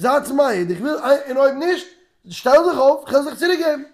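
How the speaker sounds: high-pitched, insistent demand; acted challenge. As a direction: call out to the whole crowd, high and forceful. Acting out challenge to the crowd.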